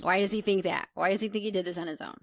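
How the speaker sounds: ordinary delivery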